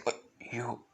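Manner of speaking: mildly surprised, voice lifting at the end; soft, halting disbelief that rises into a question; surprised king. Acted. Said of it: "With him surprised, saying".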